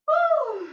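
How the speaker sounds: loud, high whoop of exertion; effort whoop. Real, not acted. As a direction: let out a big whoop mid-stretch.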